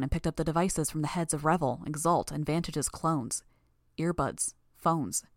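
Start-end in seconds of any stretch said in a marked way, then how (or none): none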